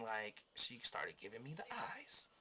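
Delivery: hushed, conspiratorial whisper; whispered aside. Real, not acted. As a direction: whisper it like a secret. Hinting at flirting.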